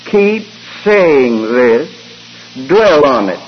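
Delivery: slow, deliberate, each word weighed; slow emphatic quote. Retold or imitated. Preacher quoting the apostle to his congregation, slow and deliberate. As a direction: drive the apostle's charge home slowly. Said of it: "he said".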